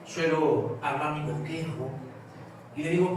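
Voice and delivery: ordinary talk, nothing marked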